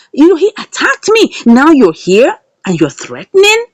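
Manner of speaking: hammed-up indignant rant, voice climbing; mock furious confrontation. Retelling Flora scolding the mother, mock angry.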